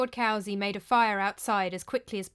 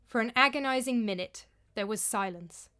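ordinary delivery

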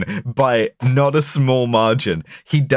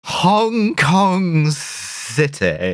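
very slow, higher, stretched-out theatrical delivery; Matt Barry; 5 semitones higher, much slower than usual. Retold or imitated. Making co-hosts laugh with an actor impression, hugely drawn out. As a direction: draw every word right out in a hammed-up actor voice. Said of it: "Look at Matt Barry".

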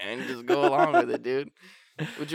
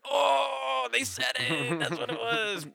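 high mock shout of a fan; mocking excited viewer; 9 semitones higher, slower than usual. Host mocking a listener who finally hears the title. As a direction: ham up a gasping viewer. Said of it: "and they're like".

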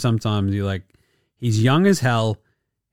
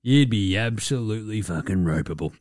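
low, softer muttered fury; fuming under breath; 7 semitones lower, 7 dB quieter than usual. Retold or imitated. Imagined teammate muttering in quiet fury at the player. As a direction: seethe it quietly to yourself. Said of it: "you've gotta be like".

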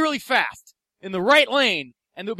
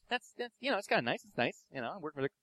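soft, halting low mumble with fillers; soft, hesitant deadpan friend voice; McGrail's way of talking; 5 semitones lower, 11 dB quieter, much faster than usual. Retold or imitated. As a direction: hesitate and mumble softly, lower. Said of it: "that's how he talks".

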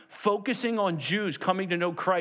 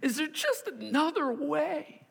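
high, drawn-out tearful plea; slow, high-pitched desperate appeal; anguished prayer; 11 semitones higher than usual. Acted. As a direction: beg the Father slowly, straining upward. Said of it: "Jesus wept in the garden".

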